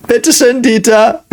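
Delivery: high, giggling reply; playful laughing voice. Pretend. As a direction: answer cheerfully through a laugh.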